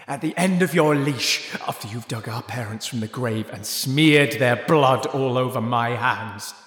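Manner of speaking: bitter retort. Acted. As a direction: spit it back at her.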